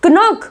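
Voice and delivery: क्नॉक as a questioning k-nock; wrong sounded k. Shown as a demonstration. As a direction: query क्नॉक, k before n. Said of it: "क्नॉक बोलोगे क्या".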